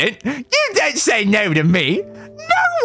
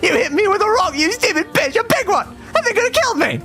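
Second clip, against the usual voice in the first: much higher, shrill-pitched outrage; furious whining boy. Acted. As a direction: rage at her in shock. Boy yells at old woman.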